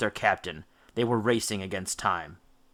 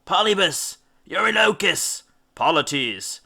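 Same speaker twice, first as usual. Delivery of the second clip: called-out names, raised; calling names; 7 semitones higher than usual. A narrator's reading. Rowers calling their names to the underworld, calling out. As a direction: call each name out high. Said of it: "his men began to call".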